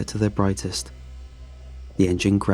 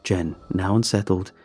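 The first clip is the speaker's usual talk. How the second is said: usual speaking voice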